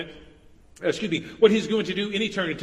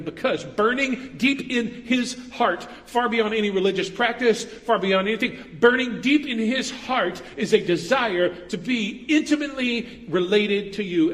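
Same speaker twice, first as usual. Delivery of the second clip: rising, fervent, louder preaching; passionate preaching; 5 semitones higher, 4 dB louder than usual. Real, not acted. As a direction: build it up with fire. Climax of the sermon.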